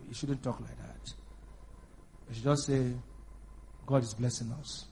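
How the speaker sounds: low, soft mock-pious voice; hushed, low pious reproach; pious religious people. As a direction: imitate meek churchgoers gently correcting you. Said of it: "You know, religious people".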